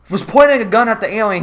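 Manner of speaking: ordinary talk, nothing marked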